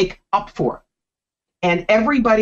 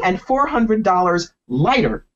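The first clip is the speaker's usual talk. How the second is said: ordinary talk, nothing marked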